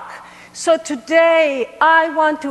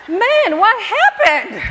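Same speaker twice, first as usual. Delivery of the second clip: amazed exclamation; 4 semitones higher, slower than usual. Retold or imitated. Amazed at sudden success.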